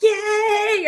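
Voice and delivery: high happy cheer from the customers. Retold or imitated. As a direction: cheer like a whole room celebrating. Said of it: "it was so much joy".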